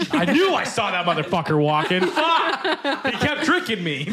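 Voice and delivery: high, hammed-up exasperation with laughter around it; mock outrage. Joking as a wife who feels tricked, mock outrage. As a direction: play-act an outburst that leaps up high on the curse. Acting out the duped wife's outburst.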